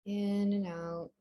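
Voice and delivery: soft, low-key breathing guidance; calm meditation voice with long, restful pauses; guided meditation. Real, not acted. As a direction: ease them into stillness, softly and unhurried.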